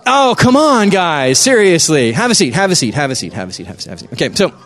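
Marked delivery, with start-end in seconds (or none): 0.1-2.1 exasperated protest. Real, not acted. Speaker to players who jumped at "go", exasperated protest. Scolding crowd that jumped the gun. 2.1-4.1 repeated order, fading. Real, not acted. Speaker seating the crowd, repeated order fading down.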